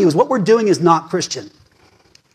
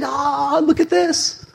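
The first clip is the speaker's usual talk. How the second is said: high, mock-triumphant shout; hammed-up gloating voice; mocking angry people; 8 semitones higher, slower than usual. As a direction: parody the gloating crowd. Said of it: "angry people saying".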